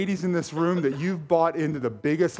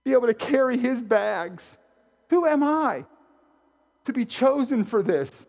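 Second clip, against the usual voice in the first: much higher, awestruck self-questioning; humbled awe; 11 semitones higher, 4 dB louder than usual. Retold or imitated. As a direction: marvel at the privilege, pitched way up. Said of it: "How privileged I felt".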